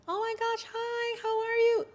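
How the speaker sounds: squeaky-high mock gushing greeting; put-on high-pitched voice. Shown as a demonstration. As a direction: exaggerate a very high voice, drawing out the end. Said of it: "You don't need to speak like that".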